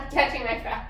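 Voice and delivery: laughing. Real, not acted. Laughs while catching her breath.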